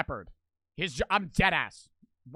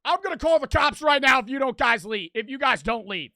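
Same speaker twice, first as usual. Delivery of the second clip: raised, high, loud voice; shouted threat; 6 semitones higher, 7 dB louder than usual. Retold or imitated. Homeowner threatening to call the cops, raised voice. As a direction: yell a warning to clear off.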